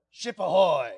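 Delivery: loud demo hail, falling at the end; pirate hail. Shown as a demonstration. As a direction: call it out to the next mate. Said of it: "go like this and go".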